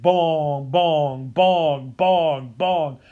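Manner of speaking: low, drawn-out bell tolls; steady sustained bonging; imitated church bell. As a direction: toll it like a big old church bell. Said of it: "And they ring it and".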